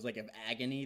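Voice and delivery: ordinary talk, nothing marked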